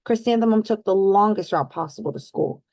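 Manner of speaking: plain reading voice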